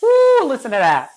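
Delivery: loud high whoop of delight; thrilled by the sizzle, said high and loud; excited. Real, not acted. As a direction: cheer at the sizzle in the pan. Onions sizzling in the pan.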